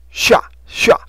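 下 with a hushing "sh" onset, loud; demonstrated consonant sound. Shown as a demonstration. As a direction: hit 下 hard with a long airy "sh". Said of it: "续的音".

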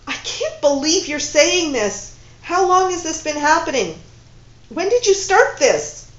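loud, high furious questions; angry demand. Acted. Woman interrogating her lover in loud disbelief.

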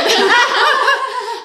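high laugh bursting out; emotional laugh. Real, not acted. Mother retelling her birth story laughs through emotion. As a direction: laugh off the rising tears. Fighting tears mid-story.

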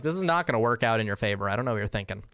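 usual speaking voice

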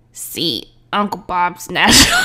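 low put-on villain voice, breaking up; attempted bad guy voice. Gamer tries a voice for the prisoner, bad guy attempt. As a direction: try a deep tough guy and push it. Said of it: "I don't know if I can do a proper voice".

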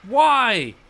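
loud, high, drawn-out oh my god; exasperated loud protest; frustrated outcry. Real, not acted. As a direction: wail at the game's unfairness. Trap fails after long effort.

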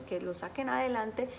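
ordinary delivery

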